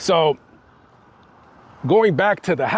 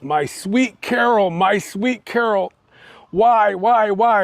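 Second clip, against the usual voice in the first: higher, slow, drawn-out lament; mock sobbing; 6 semitones higher, slower than usual. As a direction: imitate a grieving husband's wail without real tears. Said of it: "he starts sobbing and sobbing".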